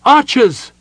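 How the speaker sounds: loud, high battlefield shout. Acted. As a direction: call the archers to arms. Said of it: "she shouted".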